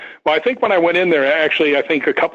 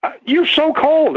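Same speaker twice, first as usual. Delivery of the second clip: put-on shocked girl; 13 semitones higher than usual. Girl grabbing his arm, high mock alarm. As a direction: exclaim it like an alarmed young woman. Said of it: "She goes".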